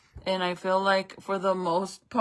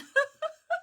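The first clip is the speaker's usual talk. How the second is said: high laugh. Real, not acted. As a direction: burst of high-pitched laughter. Laughs after scaring viewers.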